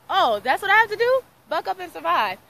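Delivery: high, loud, disbelieving "really?"; she's mocking the advice, loud and high; incredulous retort. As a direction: toss it back in stunned disbelief.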